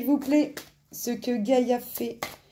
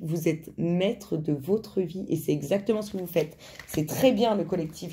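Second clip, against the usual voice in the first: ordinary talk, nothing marked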